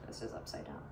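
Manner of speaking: low whispered aside to herself. Real, not acted. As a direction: mutter it off-mic.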